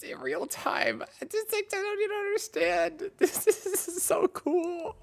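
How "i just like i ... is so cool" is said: very high, giddy squeal of amazement; overexcited, voice shooting way up; excited squeal. Real, not acted. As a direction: squeal in real astonishment. Amazed by real-time result.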